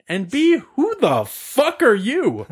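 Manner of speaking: high, drawn-out outrage; incredulous confrontation; indignant challenge. Retold or imitated. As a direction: challenge him slowly, pitch way up. Acted quarrel.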